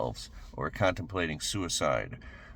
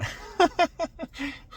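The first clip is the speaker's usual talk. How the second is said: high laugh; 3 dB louder than usual. Real, not acted. Laughing at own remark.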